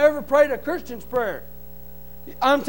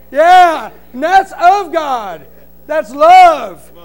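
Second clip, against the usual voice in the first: preaching at full force; 4 semitones higher, 9 dB louder than usual. Acted. Telling the church about forgiveness, loud fervent preaching. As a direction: drive it home to the congregation.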